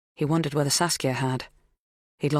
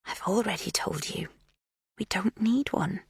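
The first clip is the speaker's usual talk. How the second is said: menacing whisper; 4 semitones higher, 5 dB quieter than usual. A narrator's reading. Magda crouched before Kate, whispering a threat. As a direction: hush it like a warning.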